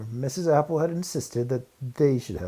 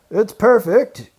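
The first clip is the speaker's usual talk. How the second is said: calling out; 11 semitones higher, 14 dB louder than usual. Acted. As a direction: shout it back so they hear you outside. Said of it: "he called back from the middle".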